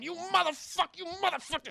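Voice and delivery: high, yelling curses at a downed man; thugs cursing. Retold or imitated. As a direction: spit it out like a street thug mid-beating. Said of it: "you know, going".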